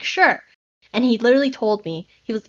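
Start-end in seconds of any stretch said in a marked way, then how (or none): 0.0-0.4 casual teacher. Retold or imitated. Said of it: "he's like".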